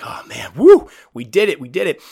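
loud, whooping cheer of relief; excited cheer. Retold or imitated. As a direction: celebrate like you pulled it off. Retold celebrating after the rehouse.

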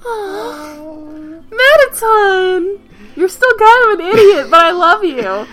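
fond, high voice, breaking into a laugh; affectionate. Retold or imitated. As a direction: coo it fondly at a silly character. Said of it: "and I was like".

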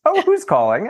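high, brisk receptionist imitation. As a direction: lightly voice the operator picking up. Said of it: "I said to the receptionist".

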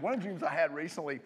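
ordinary talk, nothing marked

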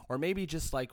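ordinary talk

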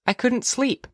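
put-on high child's voice; young girl. Daughter explaining herself to her mother, high girlish voice. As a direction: answer mother in a little girl's high voice.

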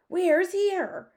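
very high, loud wail of a question; high-pitched, loud outburst; alarmed cry. A narrator's reading. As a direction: cry out in bewildered panic. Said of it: "cried the head of the army".

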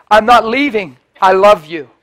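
loud, firm declaration; retold loud insistence. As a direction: plant your feet and refuse to go. Pastor insists on the girl.

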